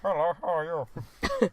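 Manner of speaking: put-on slow, high Indian accent. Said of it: "That sounded really Indian".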